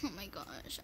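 usual speaking voice

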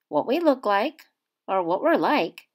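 ordinary delivery

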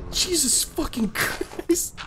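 high, slow drawn-out oath; startled curse. Real, not acted. Player after a scare, startled curse. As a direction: draw the curse out in shock.

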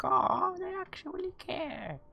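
very high, soft and sweet; a squeaky little aww of being touched; cutesy touched voice. Pretend. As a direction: gush like you're deeply moved. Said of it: "In that voice, too".

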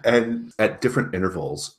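ordinary talk, nothing marked

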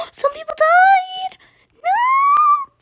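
high shocked cry of disbelief; horrified outcry; shocked gasp. Real, not acted. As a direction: gasp at the news of deaths. Players died overnight.

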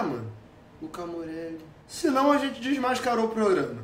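ordinary delivery

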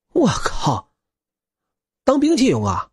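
high-pitched stunned exclamation; astonished outburst. Acted. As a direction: blurt it out, jaw dropping in disbelief. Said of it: "白龙错愕".

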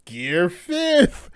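Gear fifth called out high and stretched; hyped reveal. Real, not acted. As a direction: announce it like a big reveal.

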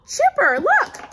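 high, loud delighted cry; excited gasp. Pretend. Host showing Chipper his clean paws, high excited delight. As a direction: burst out in delight.

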